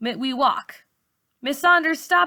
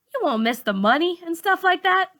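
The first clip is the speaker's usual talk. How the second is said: put-on teen girl's voice; 9 semitones higher, 3 dB louder than usual. A narrator's reading. Malika questions teacher.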